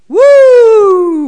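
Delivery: loud, high whoop of delight; excited whoop. Real, not acted. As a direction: let out a sudden high shout. Preacher reacts to brothers' hatred.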